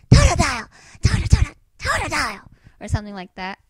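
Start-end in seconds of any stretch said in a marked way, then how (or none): 0.1-2.4 very loud, high, excited creature cries; Totodile impression. Fan remembering how it goes, shrieking Totodile. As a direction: shout the name way up high. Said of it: "it's like".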